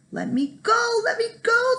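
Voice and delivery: high, loud squeaky shout; shouting leprechaun. Acted. Caught leprechaun shouts at Jamie to be released, shouting. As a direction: yell to break free. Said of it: "the leprechaun shouted".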